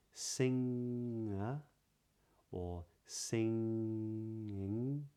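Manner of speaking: "singer" and "singing" very slow, ng with no g; "singing" drawn out, no hard g; modern british accent. Shown as a demonstration. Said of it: "the G is still not pronounced".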